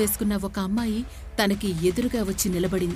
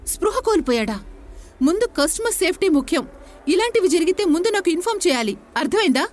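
higher and louder angry telling-off; angry scolding; 5 semitones higher, 3 dB louder than usual. A narrator's reading. Boss angrily scolds assistant about customer safety, angry telling-off. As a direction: rebuke her sharply for risking a customer. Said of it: "కోప్పడ్డాడు Bhagat".

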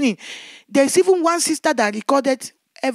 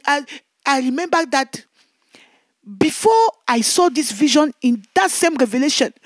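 ordinary talk

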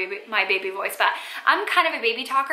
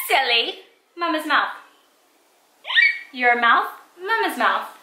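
higher, singsong mother voice; 5 semitones higher than usual. Real, not acted. Mother teaching Sydney nose and mouth, high playful baby-directed voice. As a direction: correct a little one playfully, pitch lifted. Mother correcting her toddler, naming body parts.

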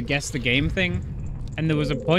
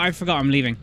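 ordinary talk

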